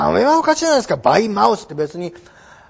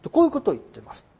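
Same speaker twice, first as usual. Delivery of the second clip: ordinary talk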